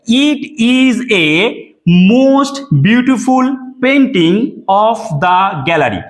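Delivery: each word of the sentence drawn out very slowly; slow dictation. Shown as a demonstration. Teacher reads the faulty sentence slowly to students. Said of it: "ভালো করে দেখবে কি লেখা রয়েছে".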